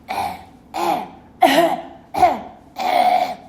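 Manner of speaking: loud, exaggerated choking noises; forced, noisy fake coughs; mock choking. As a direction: hack and gag like a kid protesting smoke. Acting son's mock choking on smoke.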